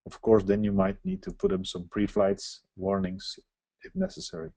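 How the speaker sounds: usual speaking voice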